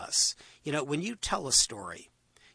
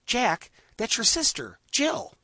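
"Jill" jumps up high; high, slow exclamation; 9 semitones higher, 6 dB louder, slower than usual. Shown as a demonstration. Storyteller showing how intensity changes meaning. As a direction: say it in high surprise. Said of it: "I can say".

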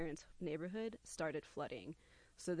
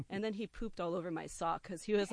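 ordinary delivery

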